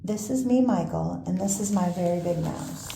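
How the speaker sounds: ordinary delivery, nothing marked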